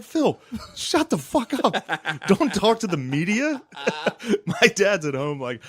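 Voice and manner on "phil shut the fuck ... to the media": high, slowed, pleading mock yell at his father; exasperated yell at dad. Yelling at dad about media.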